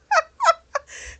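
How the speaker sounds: high-pitched real laugh. Real, not acted. Narrator amused by her own dramatic telling, laughing high. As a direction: burst out laughing mid-story.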